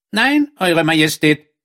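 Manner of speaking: hoch gespielter Frosch, selbstbewusst. Acted. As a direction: den Frosch deutlich höher sprechen. Frosch antwortet König. (der Frosch erklärt dem König seine Tat, hoch gespielt)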